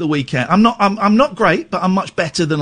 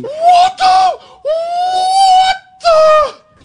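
high, loud gasping shriek; host shrieking in mock outrage at the caller; outraged gasp; 7 dB louder, much slower than usual. Real, not acted. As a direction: feign shock at the insult. Shocked at being called obnoxious.

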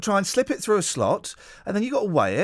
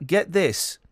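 ordinary talk, nothing marked

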